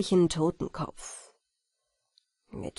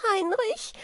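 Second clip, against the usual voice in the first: high rising call of the name Heinrich; fairy crying for her rescuer; frightened call; 12 semitones higher than usual. Acted. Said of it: "Wieglinde rief".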